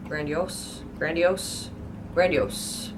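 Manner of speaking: Grandiose as GRAN-dee-ohss; correct pronunciation. Shown as a demonstration. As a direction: end Grandiose on a clear s. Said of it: "in my head".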